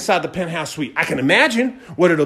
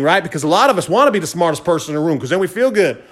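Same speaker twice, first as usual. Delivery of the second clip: normal talking voice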